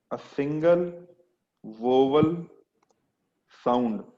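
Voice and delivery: very slow, word by word, long pauses; slow dictation. Shown as a demonstration. Teacher dictating a definition to students, slow and spaced. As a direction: dictate it so students can write.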